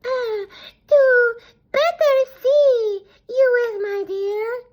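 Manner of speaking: the wolf drawls its sugary reply; sly wolf. A narrator's reading. Wolf answers the girl in a slow high mock-granny voice. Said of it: "replied the wolf".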